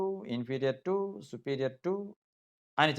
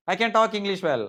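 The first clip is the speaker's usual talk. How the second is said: emphatic wrong example; 6 semitones higher, 4 dB louder than usual. Shown as a demonstration. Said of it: "ఆ చిన్న తేడా అంతే".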